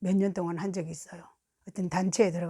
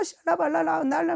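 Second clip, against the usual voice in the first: high, babbled mock tongues; frantic tongues; 6 semitones higher than usual. Imitating the woman causing a commotion, frantic tongues. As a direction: imitate a noisy outburst of prayer syllables. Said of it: "울고 난리".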